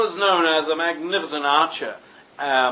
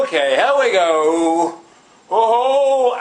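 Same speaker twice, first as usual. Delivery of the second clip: loud, drawn-out showman's build-up, rising high; showy call-out; 4 semitones higher, 7 dB louder, much slower than usual. Acted. William to the cheering fair crowd before bending back, showy call-out. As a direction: stretch it out and swoop up loudly. William showing off as he bends backwards.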